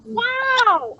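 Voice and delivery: high, loud excited wow. Real, not acted. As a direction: cheer it out in surprise. Excited reaction to child's answer.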